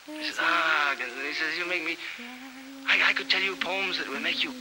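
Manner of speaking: high, airy poet's voice; high affected poet. Acted. A poet bidding a dramatic farewell, high and airy. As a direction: lift it up, precious and theatrical.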